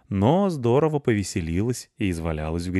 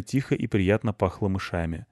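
ordinary delivery, nothing marked

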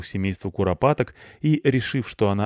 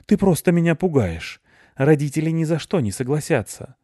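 scared protest; 8 semitones higher, 5 dB louder than usual. Acted.

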